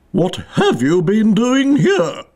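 slow, high, accusing question; haughty queen interrogation; imperious queen. A narrator's reading. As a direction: draw out the demand, pitched high.

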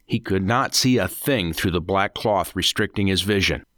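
ordinary delivery, nothing marked

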